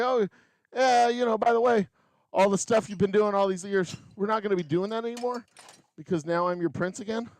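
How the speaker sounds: high-pitched mock-casual prince; lightly voiced prince, pitched well up; returning prince. Returning prince breaking news to townspeople, high and mock-casual.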